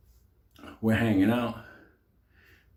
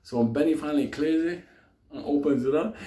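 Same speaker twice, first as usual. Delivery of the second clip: ordinary delivery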